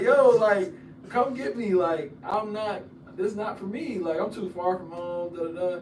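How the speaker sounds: loud, high pleading retelling, not really crying; imitated crying plea. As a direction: act out a homesick call begging mom for rescue. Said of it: "crying on the phone, calling my mom".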